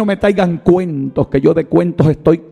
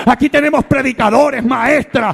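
higher and higher, ending in shouted promises; rousing preaching; 10 semitones higher than usual. Acted. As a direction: lift the church with God's promises. Preaching climax. (predicación exaltada que termina en gritos)

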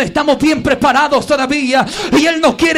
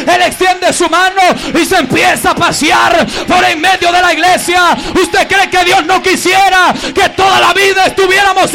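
fiery preaching; 3 semitones higher, 4 dB louder than usual. Real, not acted. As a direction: proclaim it with full fire. Preaching climax. (predicación a toda voz)

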